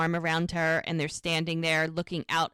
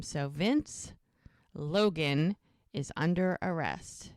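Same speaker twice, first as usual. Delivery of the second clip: ordinary talk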